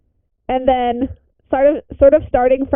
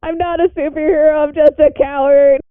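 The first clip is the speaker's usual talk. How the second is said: tearful in character; 5 semitones higher than usual. Acted. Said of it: "just sobbing".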